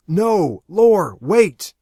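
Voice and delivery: very loud, high cry; desperate yell. Acted. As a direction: scream for her to stop. Aaron yells as Lori leaves.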